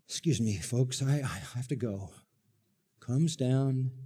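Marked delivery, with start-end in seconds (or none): 0.1-2.1 soft, polite aside; father leaving his own celebration; father excusing himself. Acted. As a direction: quietly slip away from the party. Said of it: "leaves the party".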